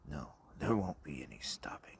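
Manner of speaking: low whispered threat; cold low whisper; menacing whisper. Acted. Father keeps up the threat.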